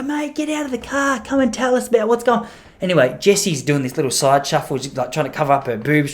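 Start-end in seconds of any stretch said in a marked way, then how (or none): 0.0-2.5 much higher, bright coaxing call; high, friendly, inviting; eager invite. Retold or imitated. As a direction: coax him out of the car with charm. Inviting stranger, charm offensive.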